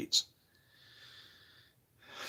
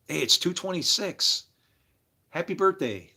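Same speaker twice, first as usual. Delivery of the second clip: cheerful greeting; 7 semitones higher than usual. Retold or imitated. Voicing a birthday greeting.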